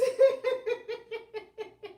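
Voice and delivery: high, falling laugh. Real, not acted. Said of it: "you just gotta laugh".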